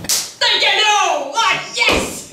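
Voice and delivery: very high, drawn-out squeal of delight; cri de joie. Retold or imitated. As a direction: celebrate like you just got a longed-for present. Joue la joie devant le cadeau. (cri de joie suraigu)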